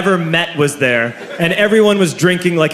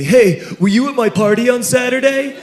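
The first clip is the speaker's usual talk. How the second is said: higher, casual curious question; teen classmate; 7 semitones higher than usual. Retold or imitated. Jake asking the comic about his party, higher casual voice. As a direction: ask it like a friendly kid. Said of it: "he says to me".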